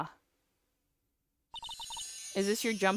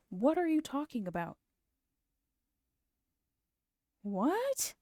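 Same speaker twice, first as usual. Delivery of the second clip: baffled; 6 semitones higher than usual. Real, not acted. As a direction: ask it in bewildered disbelief, pitch rising. Baffled at the game character.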